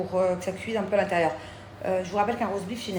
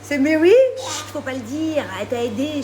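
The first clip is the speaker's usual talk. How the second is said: high sweet mom voice; 7 semitones higher, 9 dB louder than usual. Real, not acted. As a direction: coo over the gift like a proud mom. Mother cooing to child.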